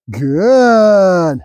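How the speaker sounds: long, stretched-out praise word; duration marker. Real, not acted. Trainer telling dog to keep waiting, drawn-out marker. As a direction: hold the word out slowly, letting it fall. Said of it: "The good duration marker".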